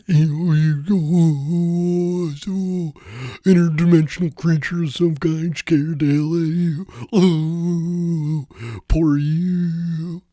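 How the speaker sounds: high, loud sneering mockery; high, loud mocking bully; Billy the Paranormal Bully. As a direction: taunt a scared person with fake pity. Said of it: "Billy the Paranormal Bully".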